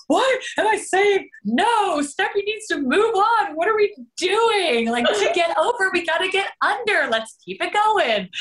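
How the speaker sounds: high, excited disbelief; excited inner voice. Retold or imitated. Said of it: "I'm looking at the pages like".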